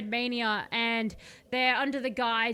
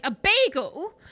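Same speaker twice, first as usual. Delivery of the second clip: baffled king; 6 semitones higher, 7 dB louder, slower than usual. Acted.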